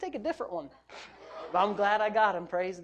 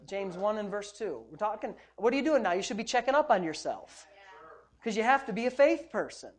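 ordinary talk, nothing marked

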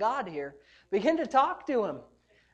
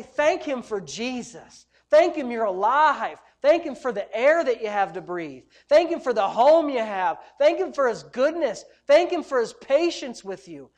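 punchy preaching with each thanks hit hard; rallying, rhythmic list, stressed words punched loud; rousing exhortation; 6 dB louder than usual. Real, not acted. Preacher urges congregation to thank God, rousing exhortation.

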